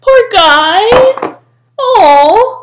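high, loud, pitying coo; sympathetic cooing. Real, not acted. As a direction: coo over him like a sad puppy. Cooing pity for the man.

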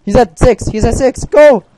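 loud, high, urgent cheer; excited cheering. Real, not acted. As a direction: egg your teammate on, loud. Enemy almost dead, cheering.

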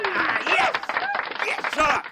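high, loud squeal of delight; excited shriek. Real, not acted. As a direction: shriek with real joy. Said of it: "I get excited around here".

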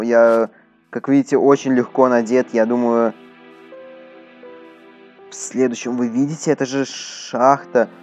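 ordinary delivery

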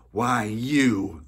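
loud, high burst of rage; furious outburst. Acted. The miller lunging at the boy, furious. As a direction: explode at someone as you lunge.

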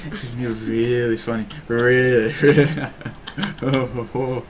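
low, soft seductive murmur; sultry mistress. Pretend. Streamer playing requested role to chat, sultry. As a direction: linger suggestively, with low little laughs. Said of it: "now you're sultry misters too".

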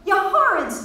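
high, loud scandalised cry; mock-outraged outburst; protesting. A narrator's reading. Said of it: "Miss Kennedy protested".

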